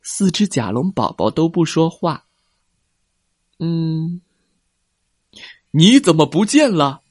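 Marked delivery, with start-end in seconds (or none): 3.6-7.0 loud roar. A narrator's reading. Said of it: "霸王龙大声吼道".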